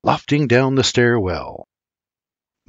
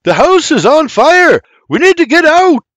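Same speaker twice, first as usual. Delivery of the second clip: high, loud warning yell; full-voice alarm over a blaze; urgent shout; 7 dB louder than usual. Acted. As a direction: shout to get everyone out. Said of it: "William shouts".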